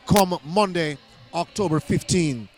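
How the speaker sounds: usual speaking voice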